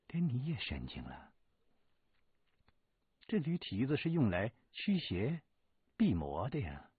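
exasperated, high and loud; annoyed. Acted. Shirley Yang scolding Hu over the donkey hoof, irritated and loud. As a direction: scold him for being mad. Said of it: "有些生气了".

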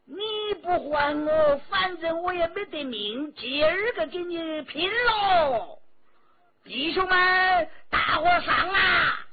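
loud, high, slowing shout; rally the men to charge; officer rallying his soldiers; desperate battle cry. A narrator's reading. Officer draws sword and charges.

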